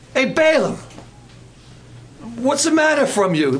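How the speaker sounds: tough-guy Brooklyn donkey; Brooklyn accent. Retold or imitated. Donkey scolding Balaam in a mock Brooklyn accent. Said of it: "the donkey was from Brooklyn".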